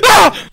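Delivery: piercing high, loud shriek; startled scream. Real, not acted. Player cooing at squirrels then scared, startled scream. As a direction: scream in sudden shock.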